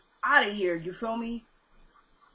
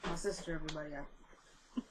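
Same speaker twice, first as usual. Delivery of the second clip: low, much softer explanation; quiet aside; 6 semitones lower, 15 dB quieter than usual. Real, not acted. Vlogger explaining the interruption by her sister, quiet aside. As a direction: drop the voice right down for the viewers.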